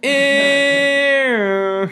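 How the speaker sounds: high, loud held tone of a passing train; loud Doppler tone. Shown as a demonstration. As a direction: belt one long high tone like a train going by. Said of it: "You want me to do it again?".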